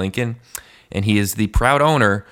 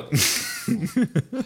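trying a character voice; 11 semitones higher, 3 dB quieter, much slower than usual. Acted. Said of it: "I gotta get a voice for him".

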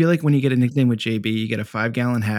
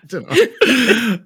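drawn-out laughing arf; 12 semitones higher, 5 dB louder, much slower than usual. Real, not acted. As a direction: say it slowly through a laugh. Repeating joke, laughing.

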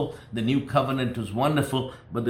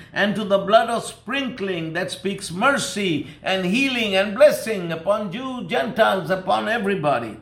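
higher, louder, rising sermon fervour; preaching at full force; 7 semitones higher, 4 dB louder than usual. Acted. Preacher exhorting the church about the new covenant, full-force preaching. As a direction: preach it out to the congregation with fire.

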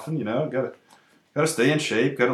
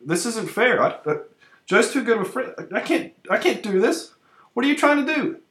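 higher, flustered protest; panicked; 7 semitones higher than usual. Acted. Panicked refusal of advances.

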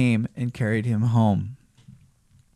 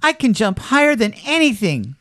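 boastful high-voiced little rabbit; proud little toy rabbit; 8 semitones higher, 3 dB louder than usual. A narrator's reading. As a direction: boast eagerly, pitched up like a small creature. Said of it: "said the little rabbit".

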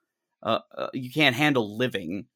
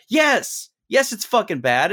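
higher, louder, venting yes; angry outburst; 10 semitones higher, 5 dB louder than usual. Real, not acted. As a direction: shout it out in frustration. Venting, answering her own doubt.

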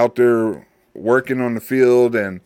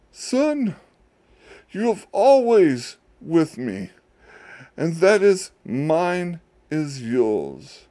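tender father; 5 semitones higher, slower than usual. Acted. Father answering the jealous son.

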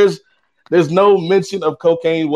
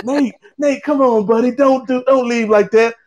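high-pitched imitation of a pleading friend; mock pleading; 7 semitones higher than usual. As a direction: coax him to stay, voice pitched way up. Friend begs him not to leave.

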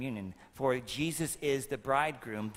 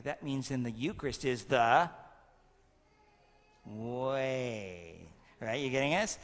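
ordinary talk, nothing marked

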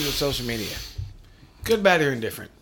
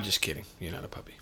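whispering; 10 semitones lower, 13 dB quieter, faster than usual. Real, not acted. Teasing aside to cohost.

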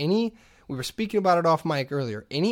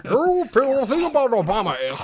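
high, loud hammed-up pundit; Rush Limbaugh eating Cheetos; 8 semitones higher, 6 dB louder than usual. Pundit addressing "you people" through Cheetos, blustering impression. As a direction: bluster it out, pitched way up. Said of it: "my Rush Limbaugh eating Cheetos is".

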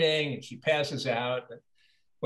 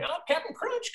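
very high, sing-song cartoon mascot call; cartoon jingle voice. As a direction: belt it like a cereal jingle. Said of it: "his crazy voices".

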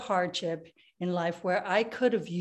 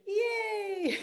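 high yay; a bright high cheer; 12 semitones higher than usual. Shown as a demonstration. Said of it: "cheer after exercise".

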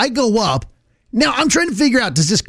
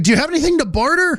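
mock fair character; 7 semitones higher, much faster than usual. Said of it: "offering if I would like to barter things".

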